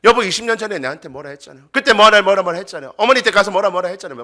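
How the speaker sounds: rapid mock scolding with loud bursts; nagging tirade. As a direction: pile up old complaints against your spouse. Acting spouse nagging about the past. (쏘아붙이는 잔소리 연기)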